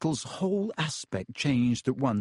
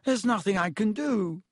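weak; 9 semitones higher than usual. A narrator's reading. Lockhart weak pleading.

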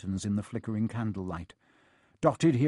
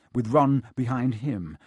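plain reading voice

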